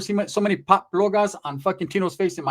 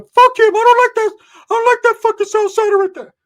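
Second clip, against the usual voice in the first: high-pitched screaming character, very loud; Ricky's outraged shriek; 11 dB louder than usual. Acted. Ricky rejects the cousin.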